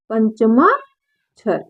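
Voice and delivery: Panchama spoken slowly, each syllable stretched; slow, drawn out. Shown as a demonstration. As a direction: stretch out पंचमा अक्षर for the class. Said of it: "इसको कहेंगे". (धीमे, खींचकर बोलना)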